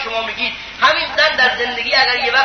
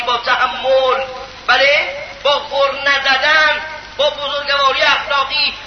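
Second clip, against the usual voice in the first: slow deliberate listing; slower than usual. Acted. Preacher slows on virtues.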